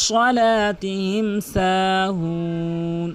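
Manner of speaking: very slow drawn-out chant; Quran recitation. Shown as a demonstration. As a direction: hold the long vowel of "সাহুন". (টানা সুরে ধীর তেলাওয়াত)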